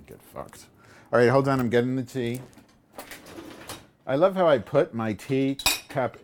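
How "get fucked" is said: muttered. Real, not acted. Muttered insult to chat.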